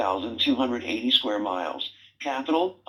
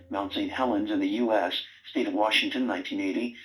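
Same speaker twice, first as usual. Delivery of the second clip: lower, even reference-book read; encyclopedia reading; 5 semitones lower than usual. Acted. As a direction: read it like a factual entry. Reads an encyclopedia entry.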